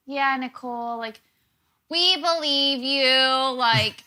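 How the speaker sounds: mock her like a smug heckler; sarcastic mockery. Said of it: "he's just in the background like".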